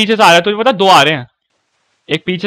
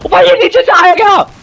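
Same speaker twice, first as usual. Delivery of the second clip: high startled yelp; startled shout; 12 semitones higher, 5 dB louder than usual. Real, not acted. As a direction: jump in shock at enemy. Startled by enemy below.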